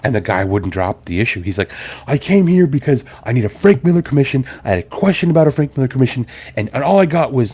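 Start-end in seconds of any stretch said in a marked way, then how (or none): 2.1-7.5 higher-pitched mock customer complaint; imitated freak-out. Customer won't drop the issue, mock freak-out. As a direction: hurry into an aggrieved, worked-up protest. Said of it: "he's just, like, freaking out".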